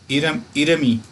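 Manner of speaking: इरमी said as one word, the म carried into ई; teacher sounding out इरमी as one flowing word; linked Korean reading. Shown as a demonstration. Teacher shows students how to read इरमी. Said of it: "इसको पढ़ा जाएगा इरमी".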